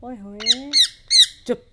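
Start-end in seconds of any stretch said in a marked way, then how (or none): 1.5-1.7 scolding hush. Real, not acted. Scolding the noisy parrot.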